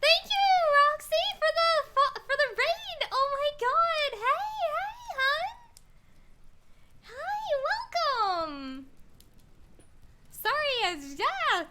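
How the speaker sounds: high, loud, thrilled squealing over the raid; excited squeal. Real, not acted. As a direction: shriek with joy as the raid lands.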